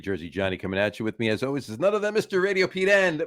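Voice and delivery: upbeat radio announcer opening; show host intro. Acted. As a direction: welcome everyone like a show host. Opens the sports show.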